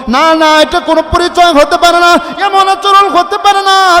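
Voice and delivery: high, loud sung denial; impassioned waz climax; forceful preaching. Acted. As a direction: cry it out to the crowd.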